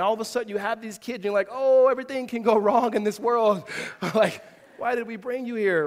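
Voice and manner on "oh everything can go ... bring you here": loud, higher-pitched worried outburst; hammed-up worry, half laughing; mock parental panic. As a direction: play up a parent's sudden dread.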